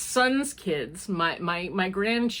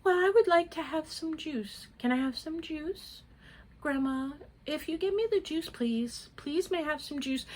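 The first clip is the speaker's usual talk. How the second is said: put-on soft, higher, sweetly polite little-girl voice; imitating polite little girl; 6 semitones higher, 7 dB quieter than usual. Grandma imitating granddaughter's polite juice request, soft and sweet. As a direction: ask for juice like a tiny well-mannered princess. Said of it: "And she's just like".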